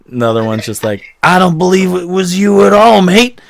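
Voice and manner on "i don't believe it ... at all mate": accusing patron. Acted. GM voices brawling tavern patron.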